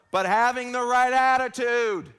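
slow and loud, punching right; emphatic loud. Shown as a demonstration. Preacher to congregation, slow and loud on right attitude. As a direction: drive the point home loudly. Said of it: "I'm not yelling at you".

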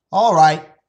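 lightly voiced father giving in; relenting father. Father yields to his daughter, loud and firm.

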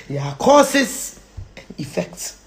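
slow, low delivery; deliberate, restrained emphasis; measured statement. Real, not acted. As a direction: state it slowly and evenly. First take before forceful repeat.

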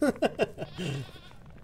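high laugh after joking; amused laughter; real laugh. Real, not acted. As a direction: laugh at your own bit. Laughs at own mock protest.

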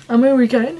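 put-on American accent on one word; playful fake American twang; mock American accent. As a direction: jokingly overdo an American accent. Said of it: "J'ai perdu mon accent en anglais".

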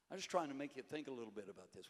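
ordinary delivery

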